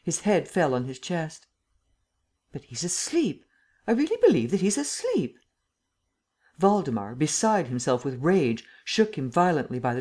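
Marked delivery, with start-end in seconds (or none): 2.5-5.4 higher-pitched disbelief; astonished, voice climbing on the realization; incredulous. A narrator's reading. The Emperor sees Lupin has dozed off, incredulous.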